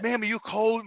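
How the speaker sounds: soft imitation of a kindly helper; quiet, considerate retold question; retold gentle concern. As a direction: ask softly, as the nice guy. Voicing the kind helper to mother.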